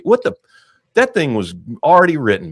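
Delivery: sudden high, loud burst of frustration; exasperated outburst. Real, not acted. Ranting to co-hosts about a sequel, exasperated. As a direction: blurt it out, fed up. Outburst over a wasted movie idea.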